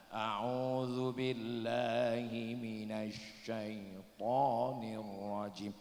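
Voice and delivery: slow, lightly intoned and soft; a hint of Quran recitation. Shown as a demonstration. Preacher imitates the qari reciting softly. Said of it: "Duduk baca Quran".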